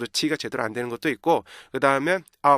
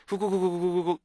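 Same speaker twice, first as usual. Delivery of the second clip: higher running string of hoo-goo-goo sounds; rapid stuttered syllables; 5 semitones higher, 4 dB quieter than usual. Shown as a demonstration. Teacher imitating joined-up rhythm, quick nonsense syllables. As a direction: rattle the syllables out on one breath. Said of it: "이렇게 가요".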